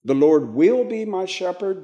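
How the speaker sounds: will lifted above the rest; stress on will. Shown as a demonstration. Teacher rules out future tense, stress on will. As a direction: raise will, keep the rest suspended. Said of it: "It's not saying".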